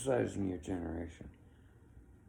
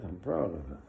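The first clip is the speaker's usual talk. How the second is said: ordinary talk, nothing marked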